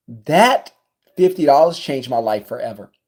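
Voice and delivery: ordinary talk, nothing marked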